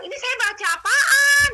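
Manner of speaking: bewildered shout. Retold or imitated. Yelling at the voice while holding the book, bewildered. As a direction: wail the question in exasperation. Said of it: "saya bingung ya".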